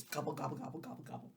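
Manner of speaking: gobble sounds fading to a whisper. Acted. Teacher reading the bear eating, fading softly. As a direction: let the gobbles die away.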